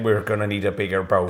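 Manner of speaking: put-on Irish accent. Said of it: "He doesn't have an Irish accent".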